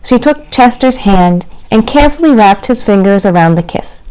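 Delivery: storybook narration for little ones; reading to children. A narrator's reading. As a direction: read it like storytime.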